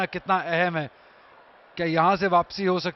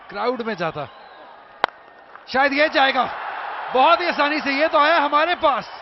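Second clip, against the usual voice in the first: higher, rising call of a six; excited cricket commentary; 6 semitones higher than usual. Real, not acted. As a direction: build up as the ball flies. Six called live. (उत्साहित cricket commentary)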